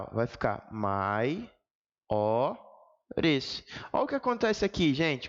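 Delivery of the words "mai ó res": MAI... Ó... RES, sílaba por sílaba; "mai", "ó", "res" com pausas longas entre as sílabas; separação silábica. Shown as a demonstration. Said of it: "Vou separar". (separando as sílabas "mai - ó - res")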